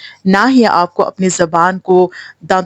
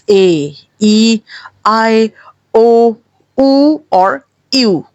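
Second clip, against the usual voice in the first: slow, drawn-out "ای، I, او" vowels; long vowel demo; much slower than usual. Shown as a demonstration. Teacher sounds out the long vowels slowly. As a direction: stretch each long vowel out. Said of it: "Now long sounds".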